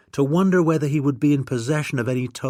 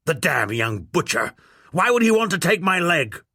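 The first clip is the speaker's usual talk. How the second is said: indignant protest; 7 semitones higher than usual. A narrator's reading. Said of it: "Lord B protested".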